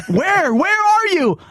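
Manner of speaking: high, panicked mock question; mock scared victim. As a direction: squeak it like a spooked victim. Acted victim in mock scene.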